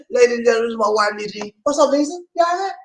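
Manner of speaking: high, affectionate host voice; high sweet greeting. Real, not acted. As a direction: greet her lovingly on air.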